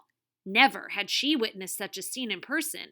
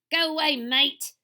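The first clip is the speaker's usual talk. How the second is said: loud, higher fake Australian accent; bogus Australian accent; 6 semitones higher, 9 dB louder than usual. A narrator's reading. TV star shoos intruders away, bogus Australian accent. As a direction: put on a bad Steve Irwin Australian twang. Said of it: "The bogus Steve Irwin accent was unmistakable".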